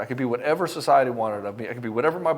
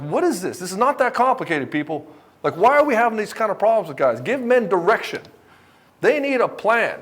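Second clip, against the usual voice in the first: higher, exasperated rant; frustrated outburst; 7 semitones higher than usual. Retold or imitated. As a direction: vent at the whole field, higher. His frustrated rant at psychology.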